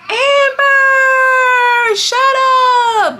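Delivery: high, loud screamed command; screaming. Retold or imitated. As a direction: scream it at full force. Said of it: "de-escalate by screaming".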